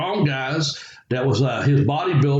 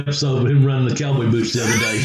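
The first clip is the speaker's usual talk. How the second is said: usual speaking voice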